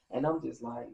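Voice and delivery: ordinary talk, nothing marked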